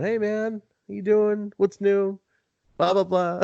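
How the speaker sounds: high, breezy mock-casual greeting; imitating Jerry. Mocking Jerry's random friendly call, high and breezy. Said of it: "giving me a call going".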